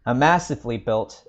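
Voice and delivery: plain reading voice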